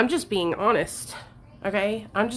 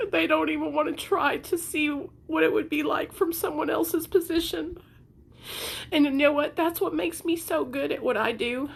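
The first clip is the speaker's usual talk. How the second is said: tearful; 7 semitones higher than usual. Real, not acted. Still tearful.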